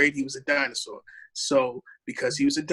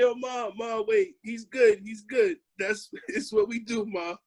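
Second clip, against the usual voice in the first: reassuring mom; 7 semitones higher than usual. Retold or imitated. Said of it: "I say".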